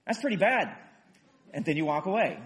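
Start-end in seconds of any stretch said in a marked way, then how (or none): 0.0-0.8 offhand sympathy. Retold or imitated. Sympathizer shrugging from above.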